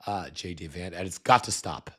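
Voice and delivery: normal talking voice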